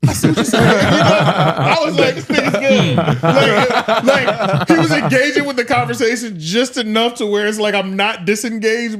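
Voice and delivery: high, loud laughing praise. Real, not acted. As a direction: laugh through the words. Laughing while praising host.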